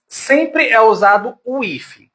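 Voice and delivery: ordinary delivery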